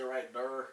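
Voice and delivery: "right, der" said with "there" slurred into "der"; slang pronunciation. Shown as a demonstration. Showing incorrect speech to friends, "right, der" with "there" as "durr". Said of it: "pronounced correctly. I ain't gotta say".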